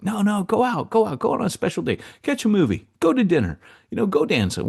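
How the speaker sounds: high eager grandparent voice, imitated. Grandparents urging tired parents out on a date, high and eager. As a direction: coax them out of the house, high and eager.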